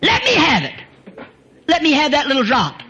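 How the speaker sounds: high, loud, slow voice of Jesus; Jesus asking for the little drop, high and slow; Jesus voiced. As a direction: call out boldly as Jesus. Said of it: "Jesus said".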